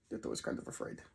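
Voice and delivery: the reader confesses fear, low and hushed; mock scared. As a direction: mutter it small and timid. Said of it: "reader admits own fear".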